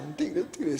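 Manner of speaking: soft panic, as if the tiger might hear; acted trembling fear. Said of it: "se pone todo tembloso". (con miedo tembloroso, en voz baja)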